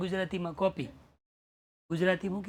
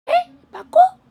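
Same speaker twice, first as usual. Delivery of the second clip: high squeaky mock disbelief, much louder; a sudden high yelp of doubt; exaggerated disbelief; 11 dB louder than usual. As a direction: jump way up in pitch, teasing. Priest mocking, really sure?.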